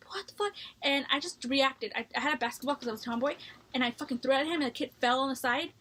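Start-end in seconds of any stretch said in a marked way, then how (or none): 0.1-0.5 shocked outburst. Retold or imitated. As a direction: pitch jumps way up in disbelief. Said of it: "And I just, like".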